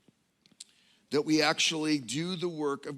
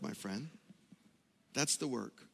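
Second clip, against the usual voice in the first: soft; 3 semitones lower, 8 dB quieter than usual. Acted. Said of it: "but that's the work".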